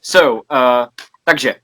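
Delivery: normal talking voice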